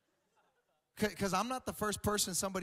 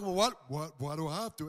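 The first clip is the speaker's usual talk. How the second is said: lightly voiced big lifter, lower; whiny gym bro; 5 semitones lower than usual. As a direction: grumble like a nagged muscle guy. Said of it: "this is how they talk".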